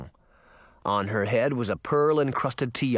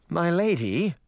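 mildly nervous, higher-pitched servant; 5 semitones higher than usual. A narrator's reading. Merman approaches the crying princess, mildly nervous. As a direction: approach your ruler with a touch of nerves. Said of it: "the skinny merman said nervously".

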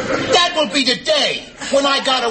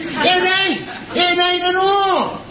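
high-pitched exasperated outburst; angry; 7 semitones higher, slower than usual. Acted. Husband fed up living with a saint, angry. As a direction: slam the point home, voice raised high.

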